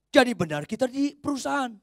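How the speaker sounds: high, loud, hammering each phrase; full-force preaching. Acted. Preacher urging Christians to read the Bible daily, full-force preaching. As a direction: drive it home with fire.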